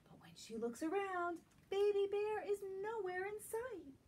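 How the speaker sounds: gentle, lilting storybook read-aloud; reading to children. Acted. Teacher reading a picture book to young children, lilting. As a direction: draw little listeners into the story.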